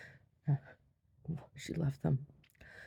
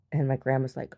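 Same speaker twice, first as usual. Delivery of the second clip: normal talking voice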